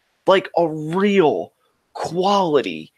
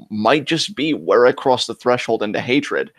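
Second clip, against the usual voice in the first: ordinary delivery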